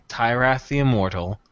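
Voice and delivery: ordinary delivery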